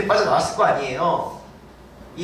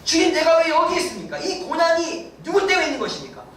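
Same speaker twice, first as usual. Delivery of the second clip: mock complaining prayer; 10 semitones higher than usual. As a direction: complain to God like a sulky child. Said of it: "근데". (투덜대는 기도 흉내)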